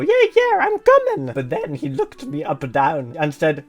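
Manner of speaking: hammed-up fake Italian accent with leaping pitch; terrible Italian accent. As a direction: put on a badly faked Italian accent. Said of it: "Use a terrible Italian accent".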